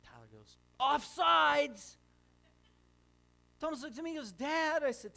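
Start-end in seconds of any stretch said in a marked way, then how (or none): 0.8-2.0 put-on high, loud little-boy call; kid calling a penalty. 4.3-4.8 high, loud whine to dad, then slow; a little boy pleading his case; protesting kid. Retold or imitated. Brother complaining to dad.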